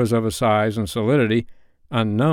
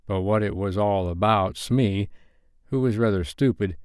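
usual speaking voice

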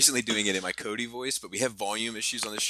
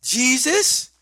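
put-on high, loud rising kid answer; mock preschooler guessing; imitating young daughter; 14 semitones higher, 8 dB louder than usual. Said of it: "She's like".

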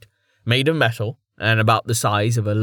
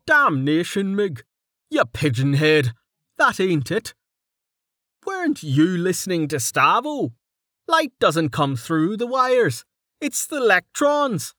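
higher, scornful Dell scolding his friend; Dell, exasperated; 8 semitones higher than usual. Acted. Dell mocks Mig's ignorance, exasperated and higher. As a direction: pitch it up and sneer at Mig.

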